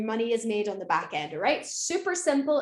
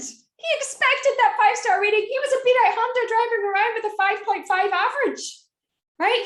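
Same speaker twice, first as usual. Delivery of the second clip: higher and louder, worked up; emphatic outrage; 9 semitones higher, 6 dB louder than usual. Real, not acted. Telling the audience how the driver demanded five stars, worked up. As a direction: rant it with mock indignation. Indignant rant about driver.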